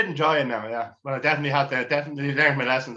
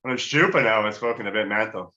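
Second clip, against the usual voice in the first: ordinary delivery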